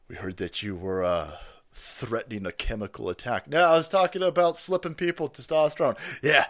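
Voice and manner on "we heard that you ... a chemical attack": low, quiet mock-official questioning; lightly voiced low-pitched investigator; stern federal agent. Federal agent to host about his joke, mock stern.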